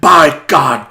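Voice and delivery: mock senator. Mock senator demanding loyalty.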